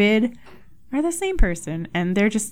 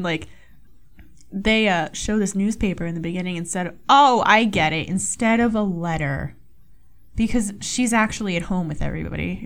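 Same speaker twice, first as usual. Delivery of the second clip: ordinary delivery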